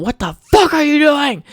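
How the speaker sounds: high, loud shouted aunt; mock furious aunt shout; angry yell. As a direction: yell it at the kids from the Jeep. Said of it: "coming up in their Jeep going".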